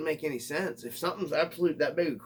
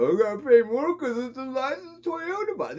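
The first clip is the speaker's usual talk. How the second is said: high, louder whining mock voice; whiny complainer; 13 semitones higher, 5 dB louder than usual. Mocking a buyer griping about price, high whine. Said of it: "I seen a guy complaining".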